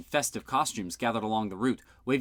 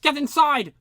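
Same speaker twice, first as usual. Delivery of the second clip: urgent shout, much higher and louder; 10 dB louder than usual. A narrator's reading. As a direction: yell it as a command. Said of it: "shouted Fergic".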